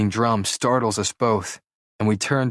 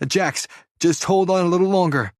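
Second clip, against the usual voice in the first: panting, high urgent plea; out of breath; 9 semitones higher, 3 dB louder than usual. Acted. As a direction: beg him to hold on, out of breath. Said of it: "I'm panting".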